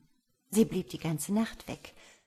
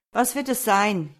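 loud, peevish retort; verdrießlich; 3 semitones higher, 8 dB louder than usual. A narrator's reading. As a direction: call it out crossly, as if bothered by the question. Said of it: "rief er verdrießlich". (verdrießlich und laut)

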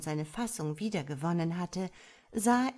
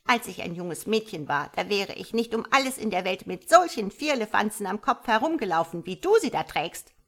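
loud indignant frog lecture; incensed; 3 semitones higher, 7 dB louder than usual. A narrator's reading. Frog mother lectures on her youth, incensed. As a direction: scold the saucy youngster with emphatic force. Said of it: "erwiderte der Frosch erbost".

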